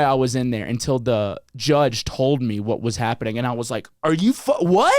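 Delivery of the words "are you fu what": retold stunned disbelief, pitched higher; incredulous. Telling friend how he reacted to the judge, incredulous. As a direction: break off in mock outrage.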